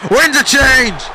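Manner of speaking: high, loud move call; excited shout. Real, not acted. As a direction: burst out at the turn in the match. Commentator calls sudden reversal.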